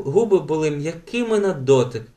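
usual speaking voice

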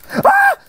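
very high piercing shriek; terrified scream. Real, not acted. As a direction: scream in sudden terror. Panthers attack.

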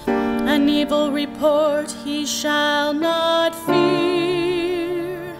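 ordinary delivery

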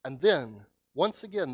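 ordinary talk, nothing marked

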